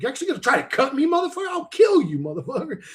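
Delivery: fast, rising furious shout; berserk rage. Pretend. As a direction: blow up at him, yelling. Imagining being attacked with a box cutter.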